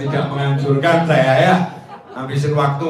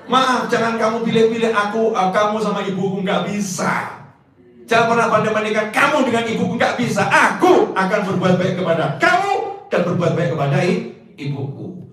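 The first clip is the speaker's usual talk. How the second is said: firm husband; 7 semitones higher, 3 dB louder than usual. Retold or imitated. Husband firmly answering wife.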